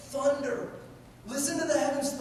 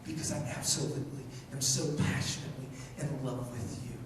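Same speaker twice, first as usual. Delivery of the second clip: quick, hushed declaration; hushed passionate confession; soft intimate; 10 semitones lower, 6 dB quieter, faster than usual. Acted. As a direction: murmur it fast and close.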